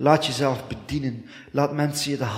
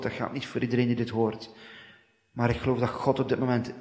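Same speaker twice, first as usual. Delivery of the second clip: ordinary talk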